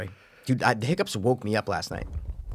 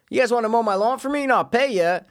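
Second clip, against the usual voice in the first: friendly call; 10 semitones higher than usual. Retold or imitated. Offering kids a job.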